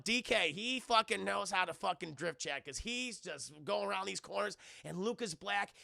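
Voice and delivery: ordinary talk, nothing marked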